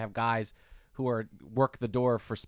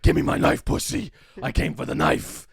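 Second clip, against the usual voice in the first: gruff, louder growled threat; Knife Guy growling a threat in a headlock; menacing thug; 6 dB louder than usual. Retold or imitated. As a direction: lean in like a street tough demanding his knife.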